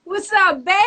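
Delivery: high, loud Rhode Island greeting; Rhode Island accent. Real, not acted. DJ hailing the host, high Rhode Island accent. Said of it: "with the Rhode Island accent".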